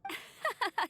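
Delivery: a short high laugh that falls away; amused laugh. Acted. Amber laughing at Eula's joke, short amused laugh.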